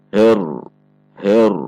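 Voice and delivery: "her" with a thick, darkened vowel before the r; heavy mispronunciation. Shown as a demonstration. Teacher showing a wrongly thickened her. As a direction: make "her" heavy, pulled toward the r. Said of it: "yang ikut tebal adalah he".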